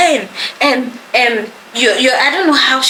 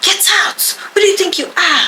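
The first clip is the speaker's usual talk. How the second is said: higher, angry shouting of orders; the teacher ordering the student out, raised and angry; imitated angry yell; 7 semitones higher than usual. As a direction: throw her out of the house, furious.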